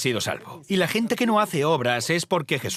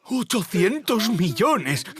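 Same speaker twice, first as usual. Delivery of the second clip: higher, slow, drawn-out awe; stunned amazement; 7 semitones higher, much slower than usual. Retold or imitated. Man seeing the millions in his bank account, stunned awe. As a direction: let the number sink in, astonished.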